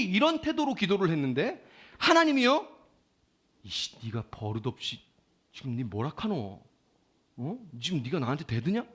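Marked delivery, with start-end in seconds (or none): 3.6-8.8 low, muttered mock scolding; imitated offended elder, low and softer; God. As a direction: grumble down at an insolent upstart. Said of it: "이렇게 하나님이 말씀하지 않았다는 거예요".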